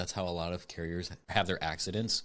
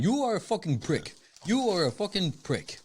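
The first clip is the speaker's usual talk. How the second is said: put-on high-pitched, indignant African accent; cussing in African accent; 9 semitones higher than usual. Owner cussing out mail carrier, imitated African accent. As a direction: imitate an African shop owner cursing someone out. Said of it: "cussing me out".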